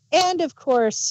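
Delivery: ordinary delivery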